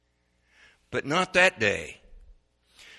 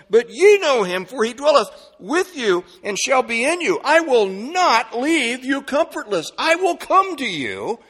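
scripture building higher and louder to full force; preached scripture reading; 8 semitones higher, 6 dB louder than usual. A narrator's reading. Preacher reading a promise to the congregation, building fervently. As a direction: proclaim the verse with rising fervour.

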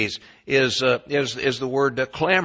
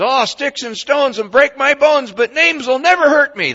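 mock sing-song; 14 semitones higher, 9 dB louder than usual. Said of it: "Somebody says".

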